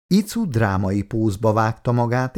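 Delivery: ordinary delivery, nothing marked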